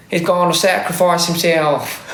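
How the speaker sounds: hammed-up mock-dramatic quote, slowly; meme quote. Quoting the meme that ruined the movie, mock-dramatic.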